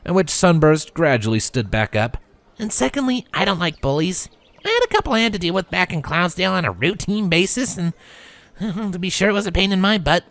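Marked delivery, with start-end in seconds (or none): 2.6-10.2 lightly voiced brash filly, casual; a hint of a cocky young mare; tomboy filly voice. A narrator's reading. Rainbow talks about bullies.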